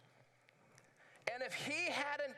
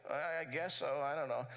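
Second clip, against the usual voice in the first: unsure mumble; 7 semitones lower than usual. Acted. Lame man voiced unsure reply.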